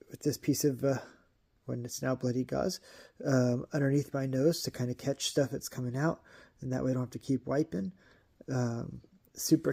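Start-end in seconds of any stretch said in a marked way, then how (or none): none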